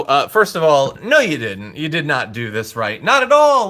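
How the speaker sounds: ordinary delivery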